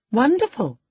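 teasing wonder; exclaiming. A narrator's reading. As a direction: cry it out in bright surprise. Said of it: "I exclaimed".

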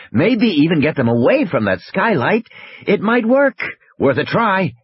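animated scientist making his case; Wu reasoning urgently. Acted. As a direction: lay out the plan with urgency.

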